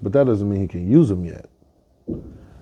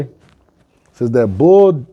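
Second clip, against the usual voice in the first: ordinary talk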